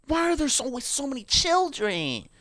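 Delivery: high incredulous complaint; exasperated wail. Real, not acted. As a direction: whine the question up high. Continues the exasperated rant.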